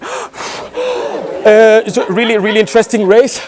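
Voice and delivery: mock breathless runner; out of breath. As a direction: puff it out after the race. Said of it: "breathing heavily".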